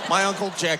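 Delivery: ordinary talk, nothing marked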